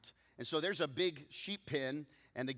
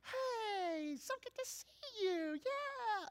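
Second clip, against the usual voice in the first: very high, squealed greeting; high-pitched mock wifely hello; imitated wife; much slower than usual. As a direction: mimic wife's voice carrying across a lobby. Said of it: "I just hear".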